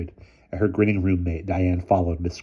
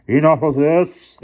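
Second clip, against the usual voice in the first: slow, loud and higher; exclaiming; 9 semitones higher, 8 dB louder, slower than usual. A narrator's reading. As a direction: stop it all at once. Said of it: "exclaimed Mister Sturgeon".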